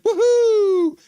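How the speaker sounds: very high, loud whoop; mock whoop. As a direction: whoop like a fan going wild. Acting out a chat cheer.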